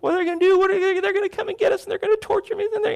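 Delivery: very high, fast, frantic whine; panicked soldier, voice way up and rushing; cowardly panic. Retold or imitated. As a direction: babble like a terrified coward. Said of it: "horribly cowardly".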